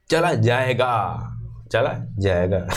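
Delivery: slow falling demo. Shown as a demonstration. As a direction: let चला जाएगा drop down. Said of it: "वो लो होता चला जाएगा".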